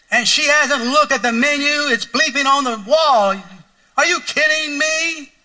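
high, loud and slow, hammed-up disbelief; exasperated outburst. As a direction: blow up in mock outrage at the counter. Pastor acting out his impatience.

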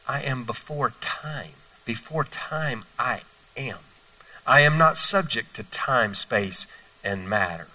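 slow, low, soft and knowing; young Jesus answering the teachers slowly and softly; calm young Jesus. Retold or imitated. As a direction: lay it out gently, unhurried, like a wise child.

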